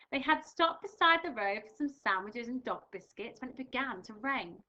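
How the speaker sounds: lively storybook read-aloud; reading to children. Acted. Teacher reading a picture book to her class, lively.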